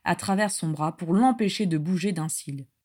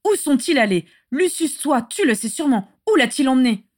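higher and louder, pressing for an answer; urgent demand; 6 semitones higher, 7 dB louder than usual. A narrator's reading. Regulus demanding Lucius tell him, urgent and loud.

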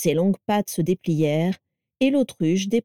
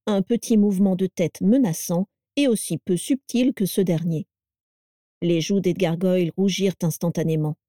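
ordinary delivery

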